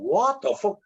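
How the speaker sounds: high, stunned exclamation; amazed disbelief. Retold or imitated. Discovering acting feels like another world, high and amazed. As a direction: gasp it out in wonder.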